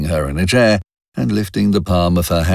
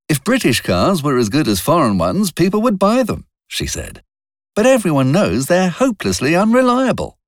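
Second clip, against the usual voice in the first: put-on a young woman's voice, clearly higher; Robin's higher voice; 11 semitones higher than usual. A narrator's reading. Robin defending her car to Wilcox, higher voice. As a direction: lift the pitch for Robin, a bit defensive.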